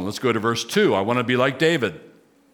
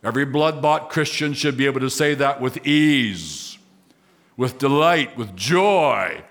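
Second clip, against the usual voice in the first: ordinary talk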